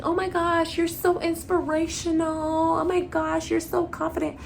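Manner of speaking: high-pitched mock fangirl gushing; gushing admirers. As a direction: imitate young girls fawning in a high voice. Imitating admiring younger girls gushing.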